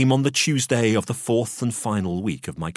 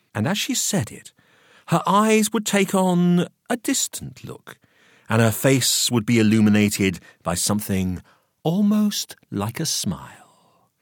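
ordinary delivery, nothing marked

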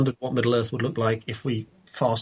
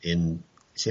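ordinary talk, nothing marked

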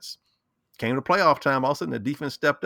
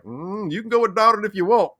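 imitated team advice; 8 semitones higher, 4 dB louder than usual. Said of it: "I'm like the Jets".

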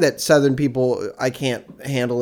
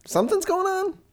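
soft high falsetto; 6 dB quieter than usual. Pretend. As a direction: float it up high. Falsetto repeat.

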